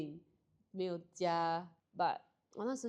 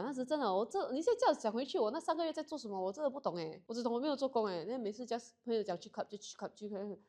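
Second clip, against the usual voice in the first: ordinary talk, nothing marked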